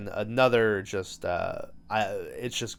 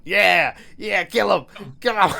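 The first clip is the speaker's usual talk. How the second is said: higher, louder cheering voice of a doll egging on a kill; gleeful killer doll; 6 semitones higher, 5 dB louder than usual. Retold or imitated. Severed doll head cheering on the strangling, higher and louder. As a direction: urge him on like a nasty little doll. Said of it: "Chucky's head is sitting there going".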